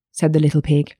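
usual speaking voice

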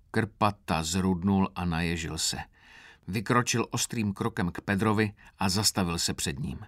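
ordinary delivery, nothing marked

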